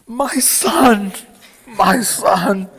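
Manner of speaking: high, quavering mom voice calling her son; a mother overcome, pleading; emotional mother. Retold or imitated. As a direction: sound like a tearful mother.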